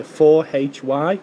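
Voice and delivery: slow spelling, letter by letter; dictating. Acted. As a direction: spell each letter out clearly. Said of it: "B for Bravo".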